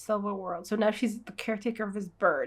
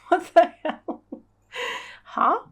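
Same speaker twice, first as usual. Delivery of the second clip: high, loud startled cry with a laugh; what the hell, then a high loud huh; shocked yelp; 7 semitones higher, 7 dB louder, slower than usual. Real, not acted. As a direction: yelp as if suddenly pecked. Reacting to the bird biting.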